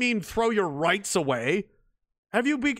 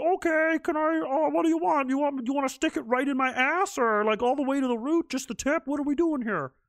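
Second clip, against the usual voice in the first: higher, eager, spineless voice; mock meek Canada; 7 semitones higher than usual. Mocking Canada giving in to the government, meek high mock voice. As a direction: play it as a limp pushover asking how to comply.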